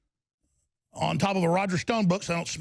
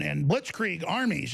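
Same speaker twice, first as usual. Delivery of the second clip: ordinary talk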